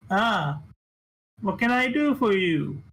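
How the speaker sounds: put-on high, eager little boy greeting guests; little kid voice. GM voicing the kid greeting the party, high and eager. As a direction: play a bouncy tiny kid at the door.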